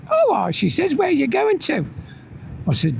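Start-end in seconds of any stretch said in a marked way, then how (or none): 0.1-0.5 higher mock wife voice; a light take on his partner's reply; imitating the wife. 1.0-1.9 lightly higher mock wife voice; quick questioning imitation of her; imitating the wife.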